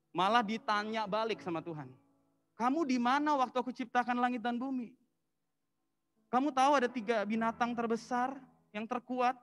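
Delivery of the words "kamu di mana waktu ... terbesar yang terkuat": higher, louder booming challenge; a mighty God firing questions at Job; God challenging. Acted. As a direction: demand an answer from Job.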